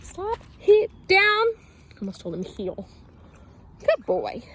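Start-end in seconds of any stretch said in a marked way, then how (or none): 0.0-1.5 high, loud string of commands; sharp commands. Real, not acted. Trainer halting the excited dog, high and loud.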